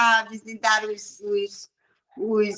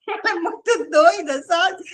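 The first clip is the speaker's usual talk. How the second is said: laughing while speaking, a bit higher; 5 semitones higher than usual. Real, not acted. The speaker amused by the girl, laughing. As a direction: chuckle through the remark. Laughing after imitating her.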